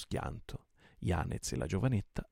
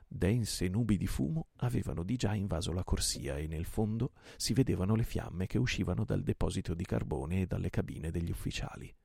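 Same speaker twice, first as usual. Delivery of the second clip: ordinary delivery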